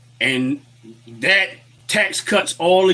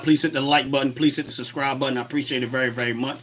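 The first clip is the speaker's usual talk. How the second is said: ordinary delivery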